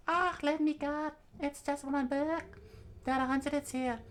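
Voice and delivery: put-on very high child voice; squeaky, put-on kid pleading; protesting little girl. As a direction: whine in a tiny high voice to be let go. Child Nami caught stealing a book.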